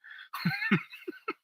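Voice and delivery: high falsetto laugh. Real, not acted. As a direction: burst into a high squeal of laughter. Laugh after the chief's admission.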